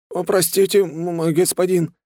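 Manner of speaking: high, stammering, servile plea; cowed apology. A narrator's reading. Cowed thug begs the hero's pardon. (испуганно, заискивающе, высоким голосом)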